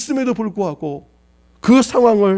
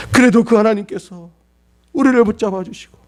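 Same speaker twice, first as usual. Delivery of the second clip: normal talking voice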